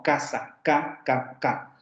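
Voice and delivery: Casa then ca, ca, ca with a crisp hard k; isolated ca syllables with a hard k; full k in casa. Shown as a demonstration. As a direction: tap out the ca syllable, hitting the k cleanly. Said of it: "como en casa".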